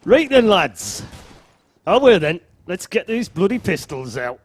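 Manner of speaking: loud mock Geordie accent. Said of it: "he's going all Geordie on me now".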